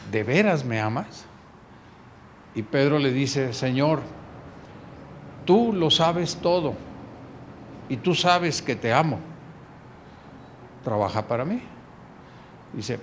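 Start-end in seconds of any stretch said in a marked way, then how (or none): 0.1-11.6 tender exchange. Acted. Tender repeated question.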